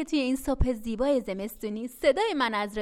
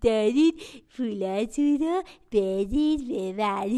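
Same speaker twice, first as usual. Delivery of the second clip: slow, louder, sly coaxing voice; sly greedy bag; 3 semitones lower, 6 dB louder, slower than usual. Acted. Greedy bag tricking the children out of their money, slow and sly. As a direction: coax the money out slowly like a crafty sack.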